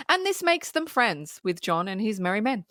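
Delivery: ordinary delivery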